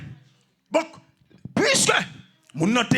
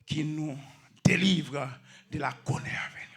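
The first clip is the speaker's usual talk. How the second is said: low, soft, measured recital with pauses; hushed recital; 7 semitones lower, 6 dB quieter than usual. Real, not acted. Preacher reciting deliverance from coming wrath, hushed and low. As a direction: let the verse sink down quietly. Reading out a solemn verse.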